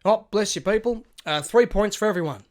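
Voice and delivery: loud, high send-off; imitated grand farewell. Eccentric stranger blessing passengers as he gets off, calling out. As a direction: announce it to the whole bus.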